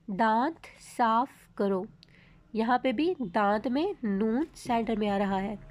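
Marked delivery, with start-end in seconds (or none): 0.1-1.8 slow, deliberate, word by word; slow clear reading. Shown as a demonstration. Teacher reading an example to students, slow and clear. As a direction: read it out carefully for learners. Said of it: "जो हम आपको पढ़ के सुनाएंगे".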